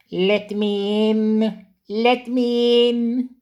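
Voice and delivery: slow, high ghostly wail; spooky voice. A narrator's reading. Percy pretending to be a ghost at the shed door, spooky wail. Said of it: "said Percy in a spooky voice".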